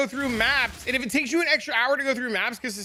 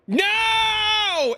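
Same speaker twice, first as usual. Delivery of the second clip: mock whiny no; 12 semitones higher, 4 dB louder than usual. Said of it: "they're like".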